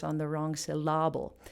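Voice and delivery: "syllable" said syl-LA-ble, stress on the middle; misplaced stress. Retold or imitated. Quoting an annoying professor, "syllable" stressed on LA. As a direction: push the stress onto the second part of "syllable". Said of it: "annoying professor that would say the emphasis".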